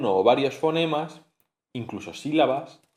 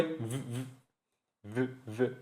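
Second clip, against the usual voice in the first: whispered v sound; 13 dB quieter, slower than usual. Shown as a demonstration. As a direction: buzz the v softly, voiceless and quiet. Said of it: "el sonido de la V".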